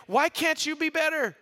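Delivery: high, loud why can't you; exasperated parent scolding; mock exasperated parent. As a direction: snap it at the kid. Parent mad at kid.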